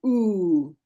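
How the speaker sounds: "oo" held as one long vowel; isolated vowel sound. Shown as a demonstration. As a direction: demonstrate a drawn-out "oo". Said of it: "it makes the oo sound".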